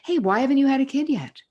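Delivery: high, loud nosy busybody voice; mock the prying acquaintance, high and loud; nosy question. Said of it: "You don't owe someone who wants to know".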